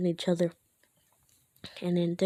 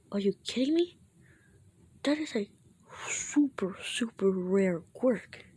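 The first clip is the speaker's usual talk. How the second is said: acted disbelief; 3 semitones higher than usual. Classmate hearing about a rare quirk, acted disbelief. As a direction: marvel like an astonished classmate.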